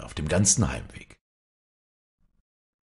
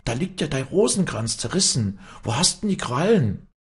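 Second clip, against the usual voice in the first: put-on high-pitched, raised old woman's voice; shrieking alarm; 10 semitones higher, 4 dB louder than usual. A narrator's reading. Old nurse points at the torn rosary, shrieking. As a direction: question her in high-pitched horror. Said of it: "kreischte die Luckhardt".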